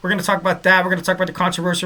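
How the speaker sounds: ordinary talk, nothing marked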